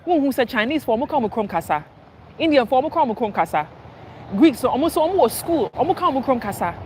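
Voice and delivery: lightly voiced Ghanaian mother insisting; mother urging her kids to speak Twi, lightly mimicked; imitating mom scolding in Twi. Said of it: "she would go on to say".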